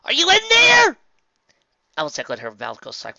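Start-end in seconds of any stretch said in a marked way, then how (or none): 0.0-1.0 calling out. A narrator's reading. Calling through a door.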